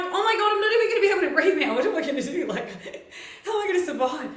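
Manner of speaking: fast and high, giggling through fake panic; retold panic broken up by laughter; laughing mock panic. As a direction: rattle it off laughing as if you can't breathe. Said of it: "because I'm like".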